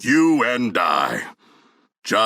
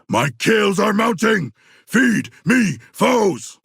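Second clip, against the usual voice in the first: bloodthirsty roar; 10 semitones higher, slower than usual. Acted. King Shark gloating over his kills, hungry roar.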